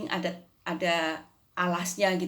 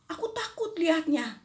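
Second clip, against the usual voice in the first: put-on scared child; 10 semitones higher than usual. Child says she is afraid.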